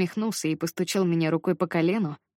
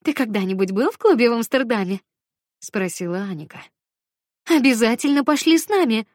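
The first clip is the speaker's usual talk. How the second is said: eager; 4 semitones higher, 5 dB louder than usual. Acted. Anika eager, loud.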